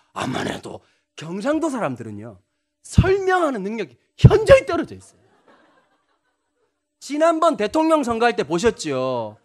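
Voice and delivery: ordinary talk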